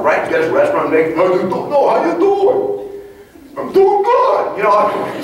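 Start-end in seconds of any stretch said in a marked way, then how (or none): 1.1-1.7 quick, lightly imitated New York Italian greeting; New York Italian accent. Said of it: "you just, from New York". 1.7-2.9 high, stretched, hammed-up Italian-American greeting; New York Italian accent. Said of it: "Just imagine a little Italian guy". 3.6-4.6 very high, hammed-up answer; mock high-pitched reply. Acted reply to the Italian guy.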